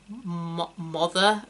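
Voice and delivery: halting, unsure American "mother"; American accent attempt. Shown as a demonstration. Brit trying to remember how Americans say mother, halting American accent. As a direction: fumble for an American "mother", false start first. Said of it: "How do you say mother in an American accent?".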